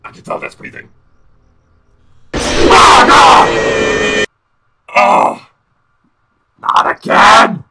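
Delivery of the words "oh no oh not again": loud startled yelling; panicked shout. Real, not acted. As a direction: yell out, caught by surprise. Jump scared again.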